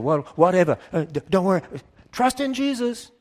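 high, loud, flustered mock church voice; mock panicked churchgoer. Mocking worried church members, high and loud. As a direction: ham up the nervous believer backing away.

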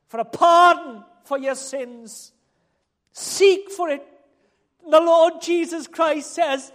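loud, slow, drawn-out proclamation; impassioned preacher in full cry; full-force preaching. Acted. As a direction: proclaim it from the pulpit, stretching key words.

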